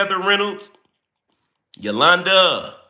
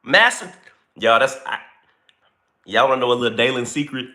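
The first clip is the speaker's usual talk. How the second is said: usual speaking voice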